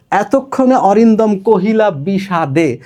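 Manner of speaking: slow, measured verse reading; poem recital. Shown as a demonstration. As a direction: read the epic line with weight. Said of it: "শুরুটা একটু দেখাই". (ধীরে কবিতা আবৃত্তি)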